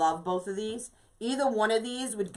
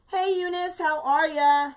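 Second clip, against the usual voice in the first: high, drawn-out hello; high-pitched welcome to a viewer joining in; cheery viewer greeting; 8 semitones higher, 5 dB louder than usual. Real, not acted. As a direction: sing out a friendly greeting. Greeting a viewer who joined.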